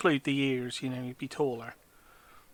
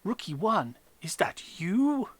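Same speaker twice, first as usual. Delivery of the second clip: slow, rising, astonished question; drawn-out surprised gasp of a line; 5 semitones higher, slower than usual. Retold or imitated. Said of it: "acts surprised when she sees you and goes".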